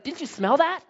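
mock exasperated wife; wife's exasperated question. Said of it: "she's like".

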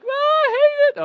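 very high, drawn-out kiddie whine; mock crying wail. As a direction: imitate girls wailing on the inner tube. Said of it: "until they start crying".

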